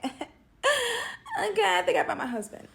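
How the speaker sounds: squealing high with a laugh in it; half-laughing, thrilled at her luck; giddy excited laugh. Retold or imitated. Gushing over a fine match.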